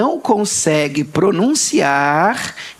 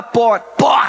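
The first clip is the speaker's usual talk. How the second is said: Carioca r; 2 semitones higher than usual. Retold or imitated. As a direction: mimic a Carioca "por". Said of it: "Aí você fala pro carioca". ("por" com r carioca)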